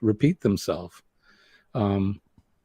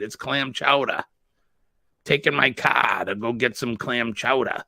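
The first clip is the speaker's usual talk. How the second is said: thick Boston accent with dropped r's, "cah" and "chowda"; New England accent. Shown as a demonstration. Host teaching how locals say it, hammed-up New England accent. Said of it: "you have to say it. We say".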